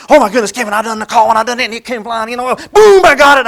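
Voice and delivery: loud, high, breathless hunting story; buddy telling Kevin about his hunt, loud and thrilled; excited buddy. Retold or imitated. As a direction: gush about the turkey call. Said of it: "he's just so excited".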